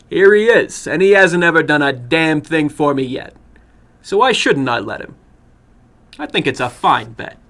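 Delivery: put-on a cocky boy shrugging off the risk. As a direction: brush it off as a bold young man. Boy holds up finger.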